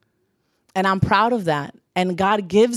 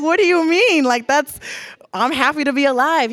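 surprised retort; 7 semitones higher than usual. Retold or imitated. Telling a friend at a party, puzzled and higher. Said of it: "I was like".

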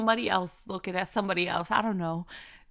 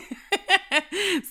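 big delighted laugh, higher and louder; loud burst of laughter; 11 semitones higher, 8 dB louder than usual. Real, not acted. Laughing at the spontaneous plan.

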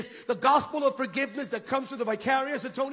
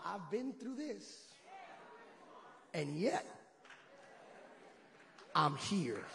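soft, slow testimony; hushed testimony; 4 semitones lower, 13 dB quieter, slower than usual. Shown as a demonstration. Congregation telling neighbours, soft testimony. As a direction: whisper it to your neighbour. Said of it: "tell them".

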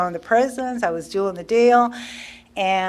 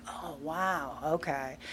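very soft, lowered reply; subdued realization; 4 semitones lower, 14 dB quieter, slower than usual. Retold or imitated. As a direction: take it in quietly, as if humbled. Retold humbled reply to sponsor's advice.

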